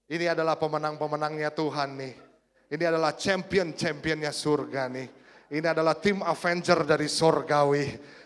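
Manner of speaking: preaching that climbs from loud to roaring; building preaching. Acted. Preacher building to full force.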